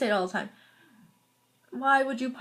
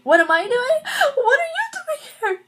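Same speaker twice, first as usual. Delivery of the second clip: shrill, loud, slow overacted cry; dramatic acting; 9 dB louder than usual. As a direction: ham up the tearful outrage. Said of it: "her dramatic acting".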